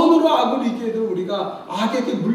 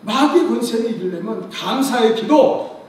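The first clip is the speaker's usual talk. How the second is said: rising, louder preacher's call; preaching at full force; 5 semitones higher, 8 dB louder than usual. Acted. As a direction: build the praise call up to a shout. Preacher building to climax. (힘차게 외치는 설교)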